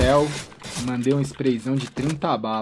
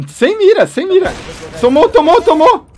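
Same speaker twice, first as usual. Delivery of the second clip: hyped shouting; 12 semitones higher, 11 dB louder than usual. Real, not acted. Hype over a lucky kill.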